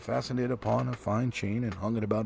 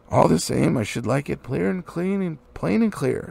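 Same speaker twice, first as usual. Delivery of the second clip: louder, firm businesslike tone; business manner; 4 semitones higher, 7 dB louder than usual. Acted. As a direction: put on a crisp business voice. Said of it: "putting on his business manner".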